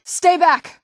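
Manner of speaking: high, loud stay back; loud warning cry; sharp warning shout. Acted. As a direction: shout them away. Warning an attacker.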